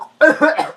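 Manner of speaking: hammed-up sick cough; fake cough. Kid fakes a cough to skip school, hammed-up. Faking sickness.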